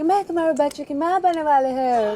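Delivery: hammed-up film line in foreign-accented Hindi; quick teasing parody of accented Hindi; mock foreign-accented Hindi. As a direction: imitate a foreigner acting in Hindi. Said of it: "ऐसे हिंदी कैसे बोलोगे?".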